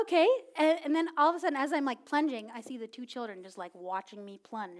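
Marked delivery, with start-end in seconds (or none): none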